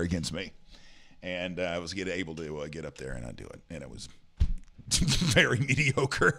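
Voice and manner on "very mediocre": higher, slow, drawn out through a laugh; laughing self-mockery. Real, not acted. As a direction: stretch the words, amused at yourself. Self-deprecating verdict.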